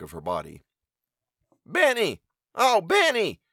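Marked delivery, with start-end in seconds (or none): 1.6-3.4 high, loud call into the evening. Acted. Mother calling her son up from the cornfield, loud high call. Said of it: "Her call echoed through the still evening".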